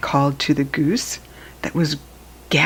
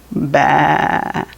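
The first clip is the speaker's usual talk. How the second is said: sheep baa; 2 semitones lower than usual. Shown as a demonstration. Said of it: "the sheep says".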